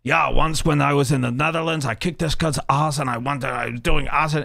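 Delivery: lightly imitated foreign-accented storyteller; put-on voice of a rambling storyteller; Sven. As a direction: retell it as the friend telling his tale. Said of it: "like, Sven was like".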